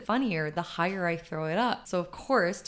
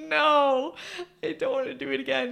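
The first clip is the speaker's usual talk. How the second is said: higher, laughing no; laughing refusal; 7 semitones higher, 4 dB louder than usual. Retold or imitated. Aunt laughing as she refuses nephew. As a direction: refuse while still laughing. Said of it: "I was like".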